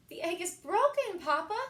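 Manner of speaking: loud, high distressed cry; a little penguin crying out in dismay; cried out. Acted. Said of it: "Pip cried".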